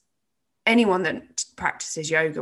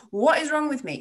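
high, mock-despairing self-jab; self-mocking what is wrong with me; 9 semitones higher than usual. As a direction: laugh at yourself. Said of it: "taking the mick out of myself".

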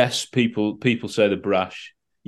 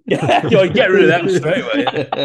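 laughing; 8 semitones higher, 4 dB louder than usual. Real, not acted. Laughing at a joke.

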